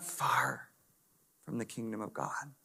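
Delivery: slow, breathy whispered repeat of "You're not far"; hushed echo of Jesus' words to the scribe. Shown as a demonstration. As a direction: lean in and whisper it slowly.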